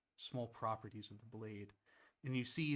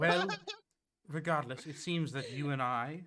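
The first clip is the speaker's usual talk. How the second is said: a loud, high-pitched god voice talking down to the player; booming, higher divine voice; deity Ron; 4 semitones higher, 7 dB louder than usual. Acted. Ron the god addressing the player, loud and lofty.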